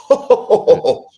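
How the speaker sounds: high, laughing haughty scoff. Retold or imitated. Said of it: "with all the haughty, down talking".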